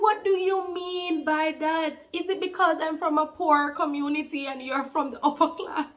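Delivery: high, hurt and defensive, line slowed at the start; demanding an answer, stung; indignant retort. Retold or imitated. Retold argument with boyfriend.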